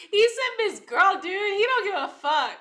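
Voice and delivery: high, loud, cracking up; amused disbelief. Real, not acted. Reacting to the clip's comeback, loud and tickled. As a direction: squeal it out through amusement.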